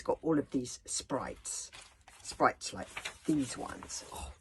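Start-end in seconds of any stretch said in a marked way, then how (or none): none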